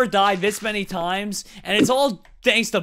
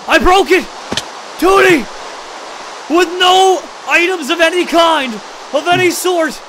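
high, loud excited yelling; loud high victory cry to Tootie; triumphant shout; 11 semitones higher, 7 dB louder than usual. Real, not acted. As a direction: celebrate loudly. Lands with no items.

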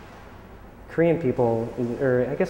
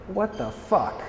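incredulous outburst; 8 semitones higher, much slower than usual. Retold or imitated. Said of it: "I'm like".

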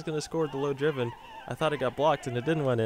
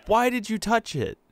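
exasperated; 8 semitones higher, 4 dB louder than usual. Real, not acted. Teammate ruins a goal.

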